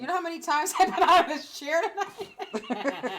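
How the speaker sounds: loud laugh mid-sentence. Real, not acted. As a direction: laugh through the words. Laughing about getting up.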